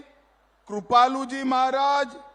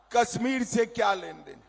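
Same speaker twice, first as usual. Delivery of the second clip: normal talking voice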